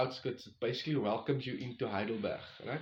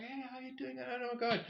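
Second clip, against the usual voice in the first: high, breathy-free squeal of surprise; surprised greeting; 11 semitones higher, 7 dB quieter than usual. Retold or imitated. Hiker spots Lanisha while paying, surprised high greeting. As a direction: act caught off guard by a friend.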